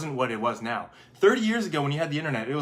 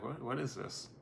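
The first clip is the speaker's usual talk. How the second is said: soft, puzzled muttering; hushed, hesitant confusion, imitated; mock bafflement; 10 dB quieter than usual. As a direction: act quietly stumped. Acts bafflement at a shoelace.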